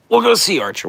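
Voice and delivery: excited. Real, not acted. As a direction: bounce up cheerfully. Sudden cheerful pitch jump.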